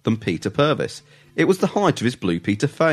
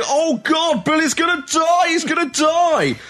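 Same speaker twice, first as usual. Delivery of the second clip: mock panic. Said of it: "and they".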